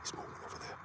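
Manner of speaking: hushed whisper to the group; real night-hunt whisper. Real, not acted. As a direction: keep it under your breath. Night watch, hiding.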